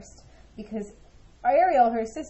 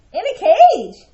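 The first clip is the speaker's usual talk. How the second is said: surprised cry; 9 semitones higher, 15 dB louder than usual. Acted. Sister surprised by bird.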